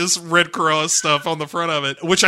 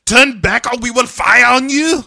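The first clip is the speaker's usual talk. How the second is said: mock German guard warning; 8 semitones higher, 6 dB louder than usual. Guard threatens to fire.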